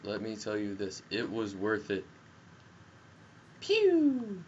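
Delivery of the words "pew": high, loud pew sound; imitated laser pew. Real, not acted. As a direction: make a cartoon blaster noise. Shooting noise.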